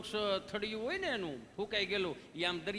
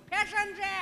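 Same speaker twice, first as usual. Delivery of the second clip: high, loud mocking voice; mocking retort; 13 semitones higher, 4 dB louder, slower than usual. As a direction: toss it off like a smug youngster. Retorting quote in joke.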